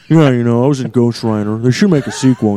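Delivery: subdued movie-star impression; Nicolas Cage. Actor pitching his film sequel, subdued impression. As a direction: drop back to a low-key, in-character murmur. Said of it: "do Nick Cage".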